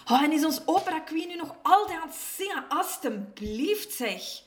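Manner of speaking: high, fast, irritated outburst; fed-up snap, drawing out the please; snapping at mama. A narrator's reading. Drunk Edward complains about the singing neighbour, snapping. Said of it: "nog steeds te snauwen op mama".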